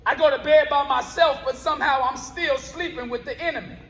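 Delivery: rhythmic, punchy spoken word delivery, words hit on the beat; spoken word poem. Acted. Poet performing his piece for a live crowd, rhythmic spoken word. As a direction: perform it like a slam poet on stage.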